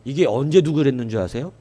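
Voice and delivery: ordinary talk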